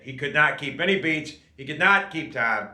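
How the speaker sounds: ordinary talk, nothing marked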